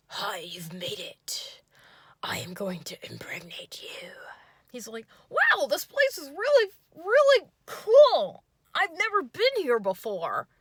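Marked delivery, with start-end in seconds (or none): 0.1-4.1 breathy imagined gangster whisper; mock sultry whisper. Imagined date whispers a mock seductive greeting. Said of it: "a badass Peaky Blinders, like, gangster accent". 5.3-10.5 much higher, louder goofy voice, slowing on really; mocking yay voice. Date gushes about the place in a high, goofy voice. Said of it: "Bill Cosby meets, like, yay voice".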